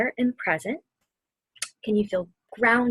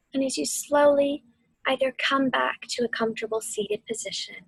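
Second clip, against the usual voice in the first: guided meditation. Acted. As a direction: ease them through the storm and back to calm.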